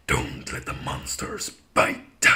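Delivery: low, slow, rasping demon voice. Pretend. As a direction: growl it like a demon. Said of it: "in my demon voice".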